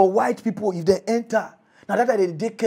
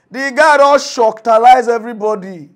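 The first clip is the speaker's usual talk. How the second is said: excited exclamation; 4 semitones higher, 13 dB louder than usual. Real, not acted. Excited exclamation about Tinubu.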